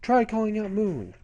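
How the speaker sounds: high-pitched, insistent cat voice; talking cat mentor. Retold or imitated. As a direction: urge a doubting pupil in a high character voice. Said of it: "And then she says".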